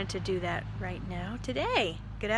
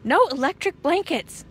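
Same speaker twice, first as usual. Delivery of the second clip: loud, higher flat refusal; firm refusal; 7 semitones higher, 8 dB louder than usual. Acted. Farmer Brown refuses electric blankets, loud and firm. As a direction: lay down the law. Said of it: "said Farmer Brown".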